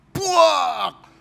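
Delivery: loud high imitated thud of a kicked chair; kick sound. Preacher retelling the possessed man kicking a chair, sharp imitated bang. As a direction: smack out the crash of the kicked pew.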